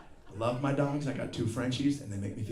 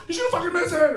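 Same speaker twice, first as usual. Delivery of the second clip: high, louder, anxious cry; mock worried passenger; 9 dB louder, faster than usual. Armchair pilot fretting at landing, high and loud. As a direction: fret loudly about the landing.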